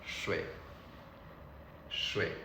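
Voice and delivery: 水 short, soft and low; simple natural pronunciation. Shown as a demonstration. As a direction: drop 水 low and brief, no drawn-out dip. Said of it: "You can just simply say".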